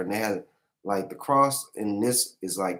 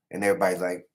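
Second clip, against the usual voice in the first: normal talking voice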